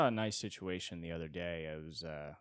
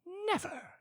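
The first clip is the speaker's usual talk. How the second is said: high, indignant denial; mock shocked denial; fake shock; 4 dB louder, much slower than usual. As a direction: squeal it as if offended. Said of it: "you're like".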